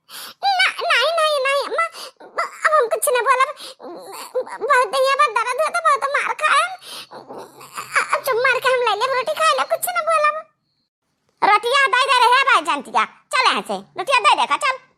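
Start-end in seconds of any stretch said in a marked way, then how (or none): 0.1-10.4 put-on pleading girl. Scolded girl pleads with mother.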